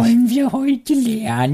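squeaky Punch-and-Judy puppet, cracking up; puppet show voice. Retold or imitated. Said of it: "Kasperle-Theatermäßig ihre Stimme verstellt hat". (hohe verstellte Kasperle-Stimme)

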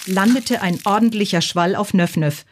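plain reading voice

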